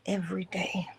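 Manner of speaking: soft whispered repeat; whispered echo. Acted. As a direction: breathe the words out as an echo.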